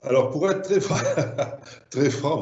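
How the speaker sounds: amused laugh breaking into the words. Real, not acted. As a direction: laugh through it, caught out. Caught out, laughs while answering. (en riant)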